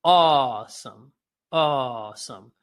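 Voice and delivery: AWESOME with a very long first vowel; slow vocal-exercise "awesome", held on AW; drawn-out demonstration. Shown as a demonstration. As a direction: stretch the AW in AWESOME slowly. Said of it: "Say, awesome".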